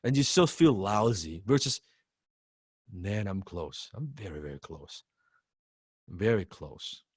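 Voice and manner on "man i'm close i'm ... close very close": much softer, hushed and close; quiet hopeful self-talk. Pretend. Student telling themself the goal is near, hushed and soft. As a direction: murmur it to yourself like you're nearly there.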